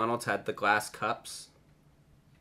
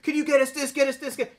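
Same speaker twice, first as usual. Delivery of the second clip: put-on high, pleading kids pestering; mock the nagging campers, high and insistent; imitating kids begging for snacks; whiny campers begging; 12 semitones higher, 3 dB louder than usual. Campers nagging the brother for stuff.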